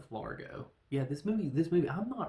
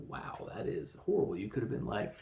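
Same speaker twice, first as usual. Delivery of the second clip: ordinary talk, nothing marked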